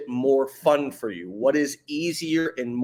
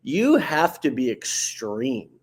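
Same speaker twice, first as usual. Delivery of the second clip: normal talking voice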